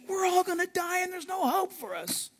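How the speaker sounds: hammed-up high despair; mock fearful cry. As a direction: wail it as a doomed man would. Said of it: "they cried out for fear".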